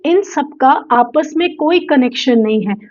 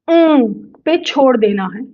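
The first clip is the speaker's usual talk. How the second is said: normal talking voice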